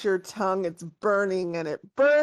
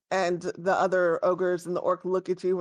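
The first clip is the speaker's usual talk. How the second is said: ordinary talk, nothing marked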